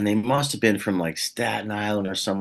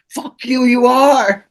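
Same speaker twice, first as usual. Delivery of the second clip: high, loud mock yell; mock shouting fans; 10 dB louder, much slower than usual. Fans in a car shouting at a lookalike, loud mock yell. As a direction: shout it back like a rowdy Staten Island fan. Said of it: "they're both like".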